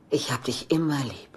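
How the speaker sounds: tender whisper. Acted. Mother to her son, a soft loving whisper. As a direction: breathe it to him like a secret.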